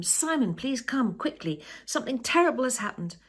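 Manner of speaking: high, loud, panicked calling out; urgent shout. Acted. Penny shouting for Simon to come quickly, loud and panicked. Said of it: "she shouts at him".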